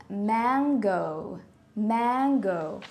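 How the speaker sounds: mango as MANG-goh, flat A as in man; correct pronunciation. Shown as a demonstration. Teacher modelling the word for learners, careful pronunciation. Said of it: "this word is pronounced as".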